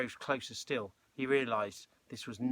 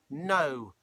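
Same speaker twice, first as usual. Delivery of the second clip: loud, higher, abrupt refusal; snapped; 4 semitones higher, 7 dB louder than usual. A narrator's reading. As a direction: snap it out firmly. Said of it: "snapped George".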